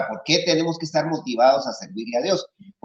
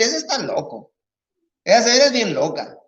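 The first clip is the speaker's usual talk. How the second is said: gossip's mock; 8 semitones higher than usual. People gossiping about a believer.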